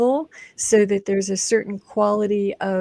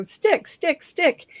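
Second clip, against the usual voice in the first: higher, insistent repeated command; commanding the coin; 8 semitones higher, much slower than usual. Retold or imitated. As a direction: order the coin to stay, in a higher voice. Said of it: "I go".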